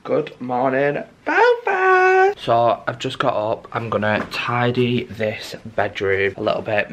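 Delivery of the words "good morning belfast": high, loud rise on Belfast; calling out. Pretend. Vlogger greeting Belfast on waking, calling out high and loud. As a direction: holler it like a show opener, soaring up at the end.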